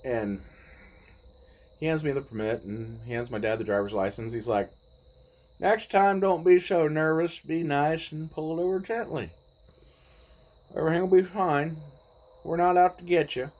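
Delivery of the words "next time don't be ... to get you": reassuring cop. Retold or imitated. Cop reassures nervous teen driver.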